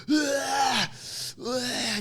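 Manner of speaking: high mock scream to pop music; imitating screaming along. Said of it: "just screaming to".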